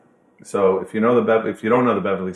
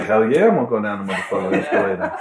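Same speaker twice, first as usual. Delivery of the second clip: fast, laughing "hell yeah"; cocky and cracking up; laughing through a fast boast; laughing bravado; faster than usual. Retold or imitated.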